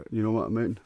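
normal talking voice

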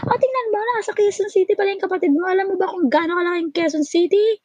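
mock the caller's logic with sarcasm; sarcastic retort. Mocking the caller about how big Quezon City is, high sarcasm. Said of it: "Sabi ko".

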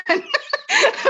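a high burst of real laughter; giggling in disbelief. Real, not acted. Laughs at her own story.

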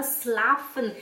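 schlafen dito “slafen”, com S puro; S errado em schlafen. Retold or imitated. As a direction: imite a aluna errando schlafen sem SH. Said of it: "você me falava schlafen". (schlafen com “s” em vez de “x”)

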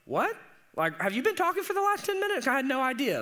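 high, fast mock dad who wasn't listening; high-pitched rushed dad, caught off guard; distracted dad. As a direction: squeak it out like a dad jolted from his thoughts. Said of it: "he's like".

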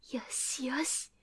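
slow unvoiced whisper. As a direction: whisper it to yourself, sneaking. Sneaking up on the wolf.

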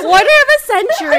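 high, loud proclamation; dramatic shout. Real, not acted. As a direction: announce it like doom. Friend echoes the age.

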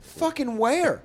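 high, slow, stunned yelp; shocked disbelief. Retold or imitated. Comic telling of bombing set, shocked high slow yelp. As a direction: pitch it way up and drag it out in disbelief. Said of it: "And I'm like".

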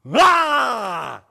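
high, loud cry of a cornered bear cub; imitated cub holler. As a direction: holler at the lion as a last defense. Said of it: "hollering with his mouth wide open as loud as he could".

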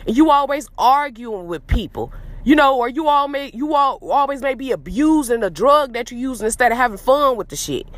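usual speaking voice

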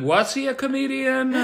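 laughing tease. Real, not acted. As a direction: ask it in mock surprise. Teasing joke.

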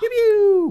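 high, loud laser zaps; mock blaster noises; laser pew sounds. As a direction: blast it like a toy ray gun.

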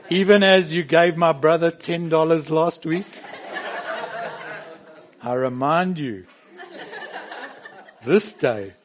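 lightly hammed-up pompous kid petition, stifling laughs; a child pleading in stiff churchy words, half laughing; mock formal prayer. Pastor mocking a child's stiff formal request to dad, hammed up with laughter. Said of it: "They don't come and say".

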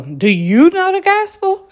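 high, loud mock evangelist pitch; mock preacher. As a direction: overdo the stranger's eager question. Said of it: "Some man just literally said".

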